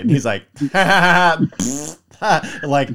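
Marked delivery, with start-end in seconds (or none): 0.5-1.9 loud, higher-pitched cackle with sputters; put-on uncontrollable laughter; imitated hysterical laugh. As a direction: mimic a kid's delirious giggle fit. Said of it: "laughing hysterically because he's making fart noises".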